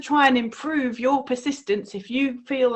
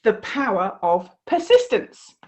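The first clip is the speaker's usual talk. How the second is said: plain reading voice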